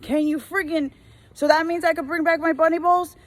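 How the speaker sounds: high mocking customer voice; mock customer whine. Retail worker mocking a customer's return request, mocking tone.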